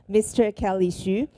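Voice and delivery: ordinary talk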